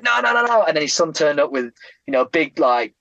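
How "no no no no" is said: high, insistent repeated no; old man refusing. Retold or imitated. Old man waving off refusal, high and insistent. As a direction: push the pitch way up, refusing. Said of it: "And he's like".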